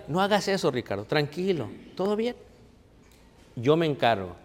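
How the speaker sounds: reassuring Jesus. Acted. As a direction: gently soothe a frightened friend, barely above a murmur. Jesus calming Ricardo.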